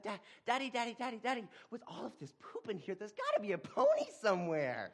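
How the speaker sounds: put-on high, giddy child voice; excited little boy. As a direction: chirp it like a delighted kid. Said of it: "Dad's like".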